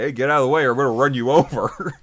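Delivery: loud, fast mock threat; mock impatient threat. Bulldozer driver warning Marco, loud impatient mock threat. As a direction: shout it brusquely, taking no nonsense. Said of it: "is not taking his shit".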